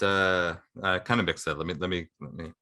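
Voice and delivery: ordinary delivery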